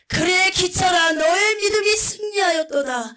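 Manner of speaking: high, loud, exultant proclamation from the throne; proclaiming a blessing over a martyr, high and loud; joyful voice of Jesus. Retold or imitated. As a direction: boom out the blessing like a rejoicing king. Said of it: "너무나 기쁜 음성으로".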